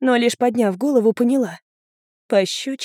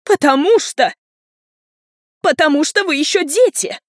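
high, loud, insistent retort; outraged; 7 semitones higher, 5 dB louder than usual. A narrator's reading. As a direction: burst out, at a loss for arguments. Parent answers in outrage. (возмущённо, срываясь на крик)

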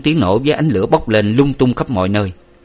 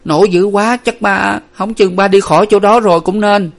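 high-pitched, halting guess; hesitant, anxious girl; faltering; 11 semitones higher, 5 dB louder than usual. A narrator's reading. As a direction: stammer out a worried explanation. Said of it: "Hà ấp úng".

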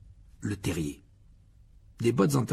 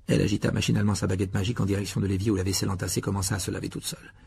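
ordinary delivery, nothing marked

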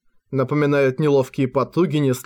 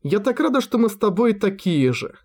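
joyful; 6 semitones higher than usual. Acted. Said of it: "улыбка так искренне передаёт чувства".

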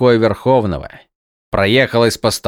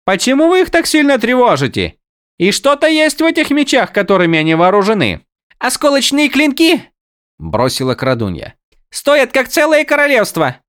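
high character voice; 4 dB louder than usual. Acted. Windel voiced, far high voice.

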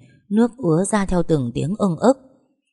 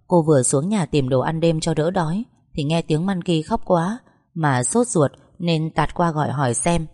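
ordinary delivery

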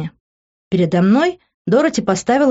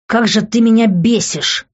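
raised exasperated outburst; angry yelling; 5 semitones higher, 4 dB louder than usual. A narrator's reading. Man fuming at her over spilled juice, angry yelling. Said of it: "продолжал он орать".

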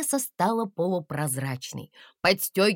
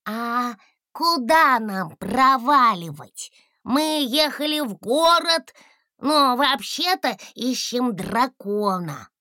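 high, slow and courteous; a small dragon asking the fog politely; polite little dragon; 6 semitones higher, 4 dB louder, slower than usual. A narrator's reading. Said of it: "вежливо обратился Гусля".

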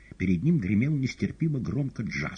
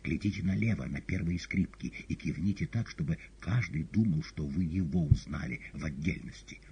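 ordinary delivery, nothing marked

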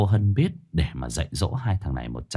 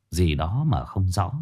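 ordinary delivery, nothing marked

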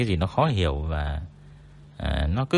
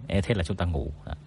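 ordinary talk, nothing marked

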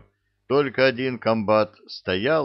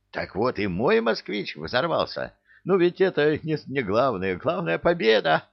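put-on higher-pitched, happy old man character; delighted old granddad; 7 semitones higher than usual. A narrator's reading. Granddad congratulates the young soldier on winning, delighted high voice.

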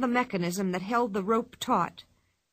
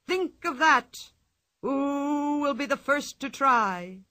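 ringmaster; 3 semitones higher than usual. Acted. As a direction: sell it like a showman. Ringmaster announcing a challenge.

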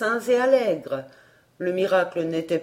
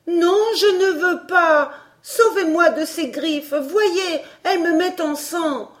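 put-on higher, louder desperate child; frightened boy pleading; 8 semitones higher, 4 dB louder than usual. A narrator's reading. Emmy to the stranger, higher and louder frightened plea. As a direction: plead for rescue in fear.

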